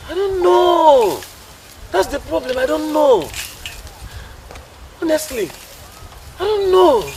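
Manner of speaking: high, loud, drawn-out helplessness; long, high cry of frustration; exasperated wail. Acted. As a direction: wail it out at the end of your rope. Exasperated, cannot explain own weakness.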